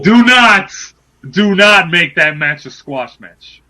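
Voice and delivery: loud outburst easing into slow insistence; emphatic demand. Real, not acted. Demanding Impact give a fair match, emphatic shout. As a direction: burst out loud, then spell it out slowly. Drops radio voice, demands it loudly.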